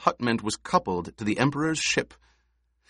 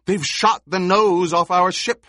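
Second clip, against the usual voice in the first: loud, high alarmed call; 9 semitones higher, 6 dB louder than usual. A narrator's reading. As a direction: call it across the room. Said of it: "someone called".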